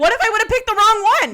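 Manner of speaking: high, loud, fast protest; exasperated defense. Real, not acted. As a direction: plead your case in a rush. Defending herself in mock panic.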